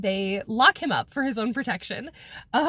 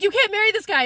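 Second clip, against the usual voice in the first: mock alarm; 9 semitones higher, 5 dB louder, faster than usual. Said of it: "so he's like".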